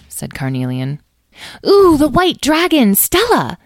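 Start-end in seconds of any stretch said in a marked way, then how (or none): 1.7-3.6 high, loud burst of discovery; excited exclamation. A narrator's reading. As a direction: squeal it out in delighted realisation. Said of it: "exclaimed Edwene".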